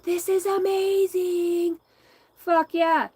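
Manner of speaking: high, loud and drawn-out delight; thrilled outburst. Real, not acted. Recalling the dream, thrilled and almost shouting. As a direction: gush with real excitement.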